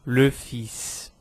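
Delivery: "le fils" with a hissed final s; S final prononcé. Shown as a demonstration. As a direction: sound the s closing "fils". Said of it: "a primeira palavra que vimos".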